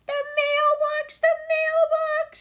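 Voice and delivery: put-on high panicked scream of a boy. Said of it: "screamed Billy".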